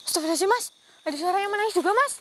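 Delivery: startled by the crying; alarmed. Real, not acted. Alarmed ghost hunter telling the cameraman, loud.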